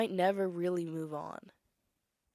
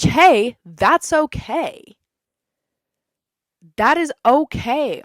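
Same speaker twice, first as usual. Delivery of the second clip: much higher and louder, slow insistence; emphatic shout; 6 semitones higher, 16 dB louder, much slower than usual. Real, not acted. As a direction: declare it loudly, defending it. Emphatic defense of bed rotting.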